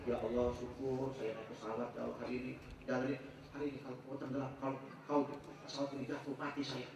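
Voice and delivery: very soft, private plea to God; hushed retelling of an anxious prayer; murmured prayer. Retold or imitated. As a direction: pray under your breath, acted out. Retold private prayer on the plane.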